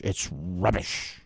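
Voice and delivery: soft, low, slow mock British accent; a hint of a British accent on rubbish. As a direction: drawl it like a posh Brit. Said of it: "British have a good phrase".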